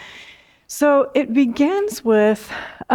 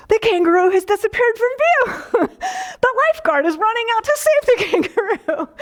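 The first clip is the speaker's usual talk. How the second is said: much higher, gentle play-by-play narrator voice, laughing at the end; kind Australian narrator; 12 semitones higher than usual. Retold or imitated. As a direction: imitate a kind Australian woman narrating the rescue. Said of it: "very kind, compassionate Australian woman's voice".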